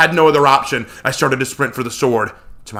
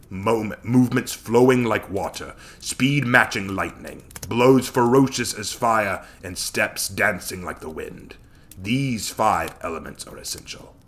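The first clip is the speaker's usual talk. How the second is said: lower, soft, measured teacher's voice; calm wise mentor; 4 semitones lower, 7 dB quieter than usual. Acted. Hachiman's remembered lesson to his student, calm and low. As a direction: recall a patient master's lesson, unhurried.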